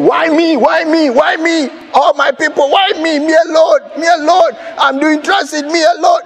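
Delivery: hammed-up self-pity lament; mock crying complaint. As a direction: wail it like a sulking child. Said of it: "I was crying and I was saying".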